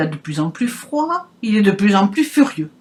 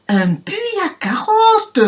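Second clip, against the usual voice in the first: much higher pig voice, crying out; cochon qui hurle; 11 semitones higher than usual. Acted. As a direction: pitch it way up and cry out for the pig. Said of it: "hurle le cochon". (le cochon crie d'une voix très aiguë)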